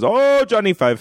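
lightly put-on Indian accent, drawing it out; a hint of an Indian accent imitation; Indian accent impression. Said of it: "Fisher Stevens is".

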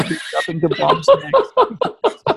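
Colonel Klink. Pretend. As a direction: play the pompous camp commandant. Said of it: "You went to Colonel Klink".

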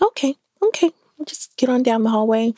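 high, coaxing okay to a tired husband; soothing reassurance. Retold or imitated. Wife coaxing tired husband down the hallway, high soothing voice. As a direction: gently humor him along. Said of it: "I was like".

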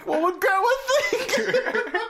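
high, squeaky voice breaking with laughter; mock mother through laughter. Quoting his mother's comment, helpless high laughter. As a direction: laugh while voicing a scandalized mom.

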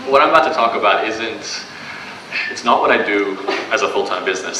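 normal talking voice

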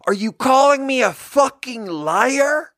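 high-pitched, slow furious shriek, drawn out; screaming accusation. Retold or imitated. Customer screams at server over a missing oyster fork, high-pitched and slow. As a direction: shriek it like the man has lost control. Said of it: "And then he screams".

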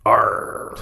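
a growled West Country pirate R; pirate R sound. Shown as a demonstration. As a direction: roll out the R like a pirate. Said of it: "So think of a pirate".

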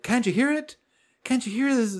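high-pitched imitation of desperate asking; distraught. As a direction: plead to be believed, retold.

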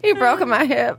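high mock old-woman complaint; old lady. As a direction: protest like an injured grandma. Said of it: "An old lady".

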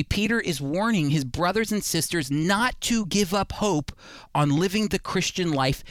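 ordinary talk, nothing marked

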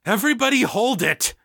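very high-pitched, panicked shout; panicky shout. A narrator's reading. Egan breaking up a violent fight, panicked shout. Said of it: "he shouted, the panic edging".

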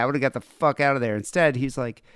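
ordinary delivery

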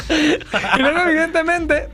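high, laughing words; words through laughter. Real, not acted.

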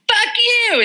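high yelled curse; playful high retort at a departing man; mock yell. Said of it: "and she's like".

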